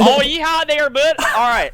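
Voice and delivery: laughing country drawl; country accent. Pretend. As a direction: play a good old cowboy, chuckling. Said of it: "can you do a country accent for me".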